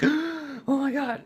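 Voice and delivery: starstruck gasp. Retold or imitated. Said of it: "that gets me, like".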